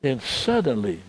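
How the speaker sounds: ordinary delivery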